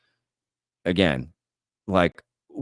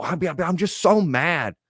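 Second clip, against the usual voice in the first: mock angry commenter; 7 semitones higher than usual. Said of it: "And they just go".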